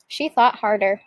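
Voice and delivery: usual speaking voice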